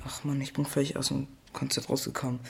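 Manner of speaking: ordinary talk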